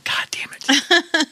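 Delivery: hissed under the breath; frustrated whisper. Real, not acted. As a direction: whisper the curse in annoyance. Frustrated at forgetting movie title.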